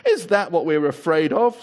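a touch of scorn; lightly mock their old fear; mild scorn. A narrator's reading. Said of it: "said another".

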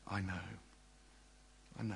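soft, low, understanding voice; hushed, low comfort; very gentle. Acted. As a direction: console softly, leaving space between the words. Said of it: "he will deal with you very gently".

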